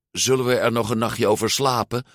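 ordinary delivery, nothing marked

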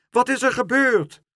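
high, loud question; alarmed shout. A narrator's reading. Soldiers running up to their horse, calling out. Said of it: "riepen ze".

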